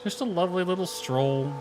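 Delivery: normal talking voice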